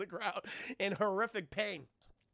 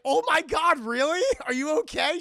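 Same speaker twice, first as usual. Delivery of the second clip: much louder, high excited rush; overjoyed gasp; 8 semitones higher, 13 dB louder than usual. Retold or imitated. Johnny Cage greeting Sonya, overjoyed. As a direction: pile on the excitement. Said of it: "He's like".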